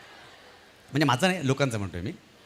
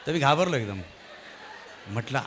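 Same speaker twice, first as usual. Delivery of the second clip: normal talking voice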